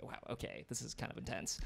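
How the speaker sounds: retold uneasy murmur under the breath; hushed awkward reaction. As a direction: imitate quietly taking in the tension. Retold stunned reaction to tension.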